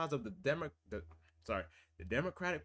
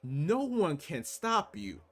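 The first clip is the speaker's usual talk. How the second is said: impassioned; 10 semitones higher, 4 dB louder than usual. Real, not acted. Impassioned rant about unity.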